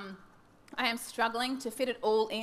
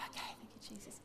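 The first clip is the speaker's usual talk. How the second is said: hushed whisper after the outburst; sheepish whispered prayer; 17 dB quieter, faster than usual. Acted. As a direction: whisper it, trying to recover calm.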